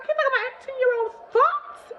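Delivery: squeaky put-on high voice; mock him with a squeak; high mocking aside; mock incredulous. Mocking the guy.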